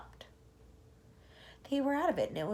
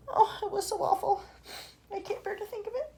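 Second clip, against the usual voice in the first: high, tearful wail; 10 semitones higher than usual. Acted. Spider mourning her aunt, high and tearful. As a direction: sob out the memory.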